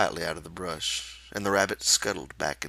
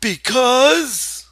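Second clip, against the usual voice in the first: put-on high, loud eager childlike voice; feed him the line eagerly; Lenny prompting; 14 dB louder than usual.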